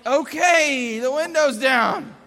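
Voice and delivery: loud, high, drawn-out okay; fed-up dad shouting his compliance; exasperated giving-in. Retold or imitated.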